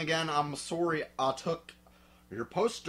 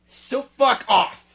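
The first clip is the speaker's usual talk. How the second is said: loud kiss-off; 8 semitones higher, 14 dB louder than usual. Acted. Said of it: "reader snaps at preps".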